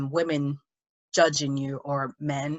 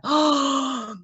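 high-pitched, drawn-out mock disbelief; mock astonishment; 8 semitones higher, much slower than usual. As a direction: gasp it, imitating someone amazed. Imitating people's shocked reaction.

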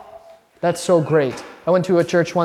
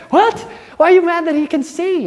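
incredulous; 11 semitones higher, 4 dB louder than usual. Retold or imitated. Retelling blind man story, incredulous.

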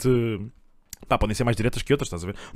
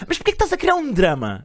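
higher, hammed-up scornful girlfriend; mock girlfriend brushing off; 8 semitones higher than usual. The girlfriend brushing off the boyfriend's complaint, higher mock scorn. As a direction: wave him off as a drama queen.

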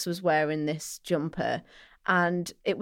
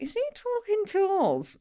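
much higher, puzzled mock query; bewildered aside; 13 semitones higher than usual. Tourist privately asking companion about a stranger, high-pitched bewilderment. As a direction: act out baffled disbelief in a high pitch.

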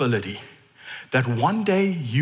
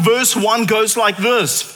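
ordinary talk

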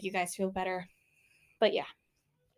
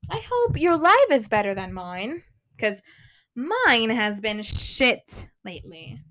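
ordinary talk

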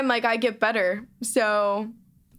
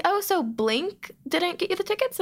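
knowing mom; 8 semitones higher than usual. Retold or imitated. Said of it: "she was like".

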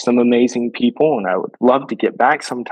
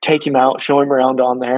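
usual speaking voice

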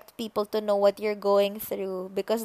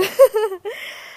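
high bright giggle after the hug; 12 semitones higher, 4 dB louder than usual. Real, not acted. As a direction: giggle at your own silliness. Laughs after audio hug.

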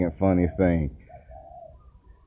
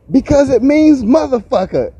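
mom snapping; 3 dB louder than usual. Retold or imitated. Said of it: "like, fucking snapped".

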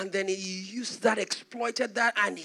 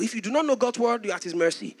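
ordinary talk, nothing marked

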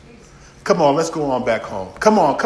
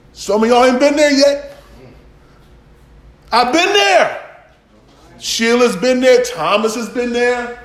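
raised, ringing sermon voice; fervent pulpit cadence, louder; preaching at full force; 9 semitones higher, 6 dB louder than usual. Acted. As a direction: press each line harder like a preacher building.

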